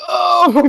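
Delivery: loud, high mock groan of agony; pained groan. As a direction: groan as if about to pass out. Acts Aki collapsing on floor.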